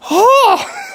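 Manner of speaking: very high squealed "oh"; real giddy squeal of joy; delighted squeal. Real, not acted. Gushing to viewers about the paint, high squeal.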